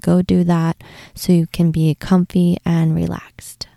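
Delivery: ordinary talk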